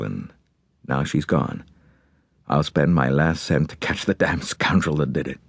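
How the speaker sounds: low-set voice of a forceful American father; American magnate. Acted. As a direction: play a grieving, determined businessman giving orders.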